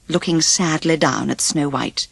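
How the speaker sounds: plain reading voice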